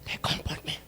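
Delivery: whisper. Acted. Quoted psalm, whispered.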